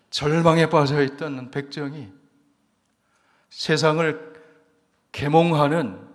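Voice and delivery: loud, rising preacher's proclamation; fervent preaching. Acted. Pastor to the congregation on the power of the gospel, loud fervent preaching. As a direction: preach it out to the congregation at full force.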